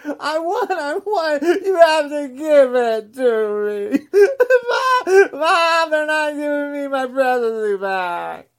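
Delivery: high, loud mock crying tantrum calling for Mom; crybaby Trump whining. Podcaster mocking Trump as a toddler crying to Mom, loud mock wailing. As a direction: wail like a spoiled toddler denied a toy, hammed up. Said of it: "he's still cheating and whining".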